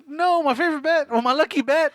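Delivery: high whiny husband voice; whiny high dad. Retold or imitated. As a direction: wail over the lost bat. Dad whines about bat.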